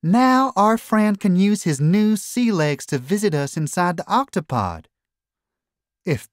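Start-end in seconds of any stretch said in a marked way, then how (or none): 0.0-4.9 high, folksy character voice; proud builder Tweak. A narrator's reading. Tweak admiring the new robo-tank, proud. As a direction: show off the work, pitched well up.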